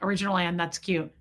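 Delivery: usual speaking voice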